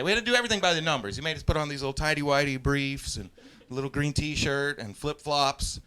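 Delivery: ordinary delivery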